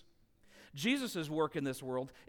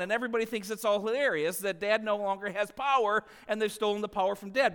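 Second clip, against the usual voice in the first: ordinary talk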